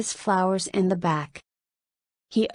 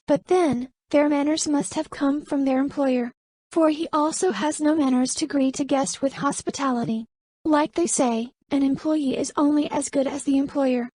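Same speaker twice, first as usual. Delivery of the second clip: higher, proud and cutting woman's voice; defiant young woman; 7 semitones higher than usual. Acted. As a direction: put him in his place, politely sharp. Narrator voices Camelia high.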